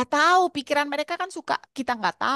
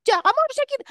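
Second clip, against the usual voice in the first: nagging mother; much faster than usual. Retold or imitated. Mother nagging teen, imitated.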